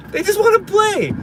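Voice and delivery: high-pitched, exasperated repeat; exasperated plea. Real, not acted. Parent lamenting turning the kids down, exasperated. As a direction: plead it high, voice dropping at the end.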